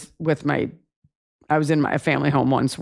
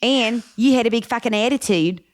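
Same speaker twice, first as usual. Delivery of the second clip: raised, accusing sister voice; guilt-tripping sibling; 5 semitones higher than usual. Pretend. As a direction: throw it at the brother as a guilt trip. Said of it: "But the ultimate guilt trip".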